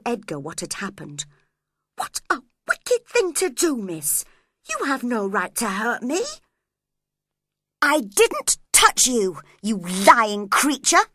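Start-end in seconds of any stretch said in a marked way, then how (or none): 2.0-6.2 a shocked reproach to her mistress; indignant protest. A narrator's reading. Ellen protests the scratch. 7.8-11.1 slow, loud furious denial; angrily. A narrator's reading. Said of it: "she cried angrily".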